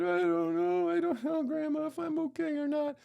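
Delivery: put-on the boy answers grandma in a tiny high voice; little boy voice. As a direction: squeak it out like a hurt four-year-old. Small child voiced in story.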